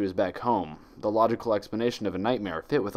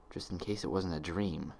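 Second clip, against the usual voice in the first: hushed, much softer private thought; inner thought; 3 semitones lower, 9 dB quieter than usual. A narrator's reading. Twilight thinking to herself, hushed and inward. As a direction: murmur it low as if only in her head. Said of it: "she thought to herself".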